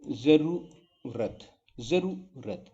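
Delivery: जरूरत broken in two, with a short रू; short vowel, split. Shown as a demonstration. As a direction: pause after a clipped रू in जरूरत. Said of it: "रा पे थोड़ा ध्यान दीजिए".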